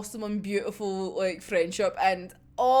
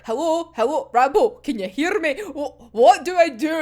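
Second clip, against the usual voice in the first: loud, frantic hello into a dead phone; mock panic, louder; hammed-up desperate caller; panicked calling out; 4 semitones higher, 7 dB louder than usual. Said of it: "He's like".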